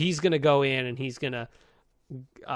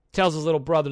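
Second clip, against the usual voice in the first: normal talking voice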